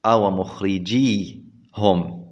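slow, soft "مخرجيهم" with a single long ee before hum; lightened ya. Shown as a demonstration. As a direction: say "مخرجيهم" as mukhrijee-hum, ya undoubled. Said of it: "بالتخفيف".

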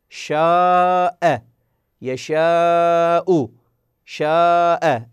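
شاء and يشاء with the alef held very long; slow teaching stretch on شاء; long madd. Shown as a demonstration. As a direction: stretch each aa fully before the hamza. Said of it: "ألف ساكنة مفتوح ما قبلها وبعدها همزة".

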